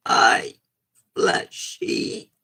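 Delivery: low rough growl of a greeting; low voice try. Shown as a demonstration. Streamer trying a low gruff take on the character. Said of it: "Can't do that".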